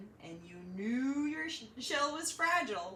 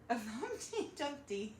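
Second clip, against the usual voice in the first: giggling through the correction; laughing at a slip; 4 semitones higher, 4 dB quieter, slower than usual. Real, not acted. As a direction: laugh at your own mistake.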